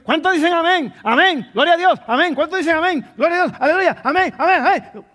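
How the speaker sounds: loud, high hammed-up preacher hollering amen; mock fiery preacher, high and loud; mocking a shouting preacher. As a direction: parody a preacher whipping up the crowd. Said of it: "hay gente que está predicando".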